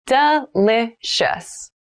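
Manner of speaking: slow, drawn-out teacher demo; slow model read. Shown as a demonstration. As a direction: stretch the word for learners. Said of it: "teacher models word, third take".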